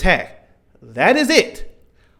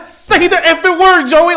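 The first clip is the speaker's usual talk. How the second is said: higher and louder, punching it out; loud heated demand at Joey; furious yell; 10 semitones higher, 6 dB louder than usual. Retold or imitated. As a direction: yell at the cartoon like a frustrated fan. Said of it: "Like, I was so mad".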